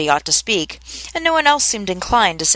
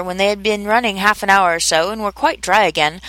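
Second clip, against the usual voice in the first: ordinary delivery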